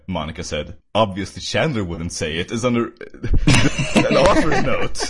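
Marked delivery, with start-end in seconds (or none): 2.9-5.0 louder words through laughter; laughing. Real, not acted. Reader finds an author's note mid-story, laughing incredulously. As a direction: laugh while reading it out.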